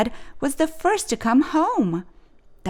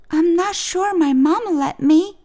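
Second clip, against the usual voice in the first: Ruby's voice; 8 semitones higher, 3 dB louder than usual. A narrator's reading. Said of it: "said Ruby".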